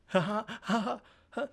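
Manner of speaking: high, soft put-on "ha ha"; mock laughter. Pretend response to the airplane food joke, high mock laughter. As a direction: fake a laugh at a stale joke.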